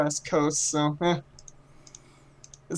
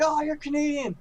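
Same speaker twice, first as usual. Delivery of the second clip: higher, delighted recognition; light mock delight at spotting a countryman; delighted Canadian; 10 semitones higher than usual. Retelling Canadians spotting the cape, delighted and higher. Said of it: "they're like".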